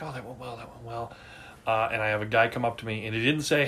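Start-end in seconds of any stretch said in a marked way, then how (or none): 0.0-1.1 self-satisfied murmur. Retold or imitated. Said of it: "We're like".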